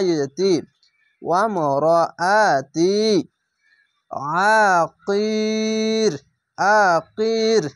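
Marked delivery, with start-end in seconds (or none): 4.4-7.6 আকির held long, ending on a stopped র; stopping with sukun. Shown as a demonstration. Teacher showing the pause on আকির. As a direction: hold আকির and stop dead on the র. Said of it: "এভাবে থেমে যাবেন".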